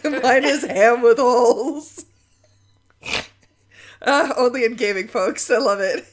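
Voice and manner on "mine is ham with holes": mock proud. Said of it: "You're like".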